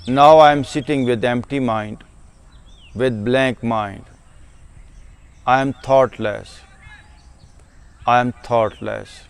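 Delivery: very slow, measured meditation voice; unhurried self-affirmation, letting each phrase sink in. Shown as a demonstration. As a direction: settle slowly into each affirmation, with long pauses. Models meditation self-statements.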